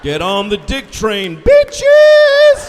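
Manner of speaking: screamed high and loud; hammed-up war whoop; mock battle cry. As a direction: yell it like a rally cry at the crowd.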